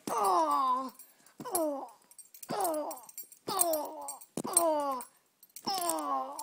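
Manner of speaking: loud pained moans, hammed-up; pained groans. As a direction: moan in hammy pain over and over. Pet hurt and broken.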